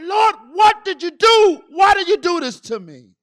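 loud, high retold plea; cry of anguish. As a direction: demand an answer from God in pain. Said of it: "I cried out in anguish".

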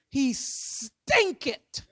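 "stinketh" dragged out slowly; drawn-out verdict. Retold or imitated. Martha warning Jesus about the tomb, "stinketh" slowly drawn out. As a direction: linger long on the start of "stinketh".

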